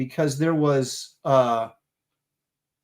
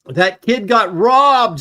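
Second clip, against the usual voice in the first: mock indignant; 6 semitones higher, 8 dB louder, much slower than usual. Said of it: "says inconsequential artist".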